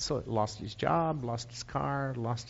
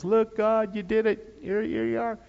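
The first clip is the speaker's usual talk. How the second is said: 1 Sarah proud; 6 semitones higher than usual. Retold or imitated. Sarah voiced in retold story.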